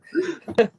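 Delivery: sudden high burst of laughter. Real, not acted. Cracking up at the movie quote.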